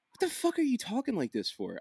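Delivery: imitating a baffled wife's question; mock incredulous. Husband retelling his wife questioning his dialect, mock incredulous.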